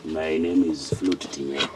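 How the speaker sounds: low, put-on deep voice. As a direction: drop the voice low. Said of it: "You have a very deep voice".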